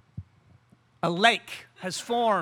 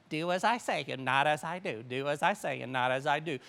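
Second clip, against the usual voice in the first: low, soft recited saying; quoted catchphrase; 6 semitones lower, 6 dB quieter than usual. Acted. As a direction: say it lower and softer, like a rote saying. Mimics a parental catchphrase.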